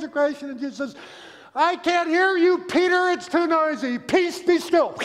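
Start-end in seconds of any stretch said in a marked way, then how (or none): 1.5-5.0 high, loud exasperated yell; shouting over noise. Retold or imitated. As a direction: holler over a roaring storm. Acts Jesus shouting over storm noise.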